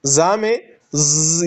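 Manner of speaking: ز held as a long buzzing whistle; slow buzz demo. Shown as a demonstration. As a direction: stretch the ز slowly. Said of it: "یہ آواز".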